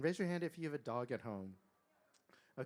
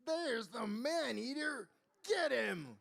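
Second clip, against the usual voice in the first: high, loud alarmed mob cry; yelling; 9 semitones higher, 5 dB louder, slower than usual. A narrator's reading. Parents set villagers on the tiger, yelling. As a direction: yell it out to rally the hunt. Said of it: "who yelled".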